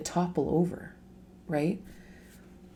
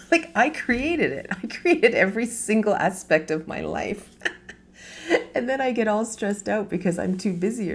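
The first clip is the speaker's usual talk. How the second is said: light laughter under the words; amused disbelief at herself; laughing; 3 semitones higher than usual. Real, not acted. Laughing about stressing over her own life. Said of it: "I always laugh too".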